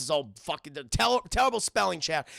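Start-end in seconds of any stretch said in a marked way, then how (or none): none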